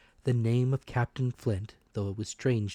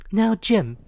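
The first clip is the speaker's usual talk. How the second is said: higher, louder, a brave scolding mother; mother's defiant voice; 12 semitones higher, 10 dB louder than usual. A narrator's reading. Mother scolding the villagers, high and loud. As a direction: shame the men into helping.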